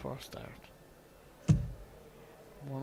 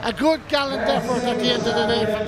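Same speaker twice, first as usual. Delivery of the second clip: excited; 7 semitones higher, 11 dB louder than usual. Real, not acted. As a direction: call the winning moment with excitement. Commentator at the match win.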